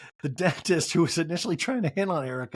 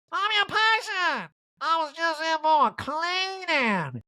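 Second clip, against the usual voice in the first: very high, slow, dazed diva calling out; Erica impression; 13 semitones higher, slower than usual. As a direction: drag out a lost, high calling voice. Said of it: "And Erica yells".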